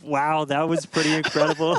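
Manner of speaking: high, breathless wow; amazed. Real, not acted. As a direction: awestruck after the launch. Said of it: "I'm still recovering".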